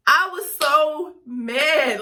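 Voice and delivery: slow emphatic outburst; loud and drawn out. Real, not acted. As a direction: stretch it out, loud and upset. Emphatic upset recollection.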